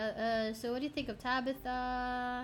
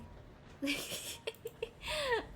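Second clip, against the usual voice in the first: high, airy giggle; long high-pitched tittering laugh; amused giggle; 13 semitones higher, 3 dB quieter than usual. Real, not acted. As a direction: giggle at your own silly accent. Amused by own accent attempt.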